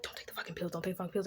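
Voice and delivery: urgent whisper, imitating a secret warning; hushed, whispered quote of a warning. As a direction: hiss it under your breath, repeated. Voices a warning to the patient.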